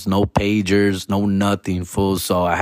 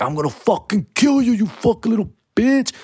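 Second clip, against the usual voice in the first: higher put-on menacing voice; imitated angry threat; 10 semitones higher than usual. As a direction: threaten the kid you caught. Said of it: "I'm motherfucker's like".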